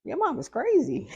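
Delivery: mocking the stepbrother. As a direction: mimic a stubborn kid talking back. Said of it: "And it was like".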